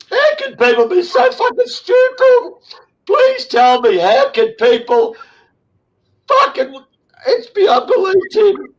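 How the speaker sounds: pleading disbelief, near sobbing; anguished outburst. Real, not acted. Begging the host to explain, anguished and tearful. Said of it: "I gotta control myself".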